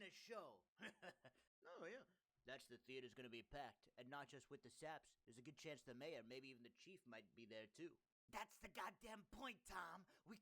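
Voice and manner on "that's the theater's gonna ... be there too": low mobster voice. Acted. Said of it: "I can't do these voices".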